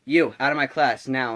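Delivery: retold barked dismissal; mock angry order. Substitute cutting off boy asking for notes, angry.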